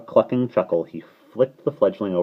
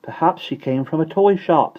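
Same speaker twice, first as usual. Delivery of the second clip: put-on high, loud small-boy voice; piping boyish suggestion; child guessing; 11 semitones higher, 6 dB louder than usual. A narrator's reading. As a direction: offer the idea like a child.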